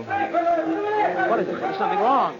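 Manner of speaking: a high, long, drawn-out gasp of a question; startled, stretching every word; alarmed. Acted. Husband spots something wrong at the Ferris wheel, high and drawn out.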